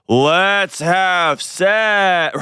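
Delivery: very slow, high, drawn-out mock plea; mock slow talking. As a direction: stretch every word, as if talking to a foreigner. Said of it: "they just talk English really slow and really loud".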